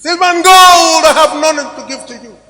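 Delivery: booming quote. Retold or imitated. Retelling a pastor's blessing at the airport, booming proclamation. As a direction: declaim it to the rafters.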